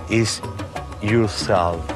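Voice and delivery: usual speaking voice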